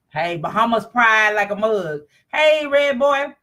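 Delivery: normal talking voice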